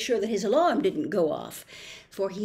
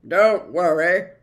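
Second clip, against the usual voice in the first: growling dog; 10 dB louder than usual. A narrator's reading. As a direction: growl it kindly. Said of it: "growled Tock".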